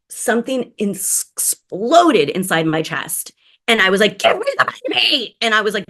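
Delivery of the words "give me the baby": high-pitched urgent demand; sudden high squawk; urgent cry. Retold or imitated. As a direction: squeal it out like a panicked new mother. Urgent demand at baby's first night.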